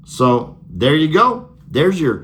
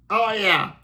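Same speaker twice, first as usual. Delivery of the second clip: ordinary talk, nothing marked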